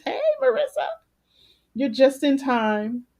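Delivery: high, bright call to a newcomer; excited greeting. Real, not acted. Greets viewer joining live.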